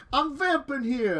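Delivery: loud, slow New York drawl; hammed-up New York accent. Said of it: "my bad New York accent".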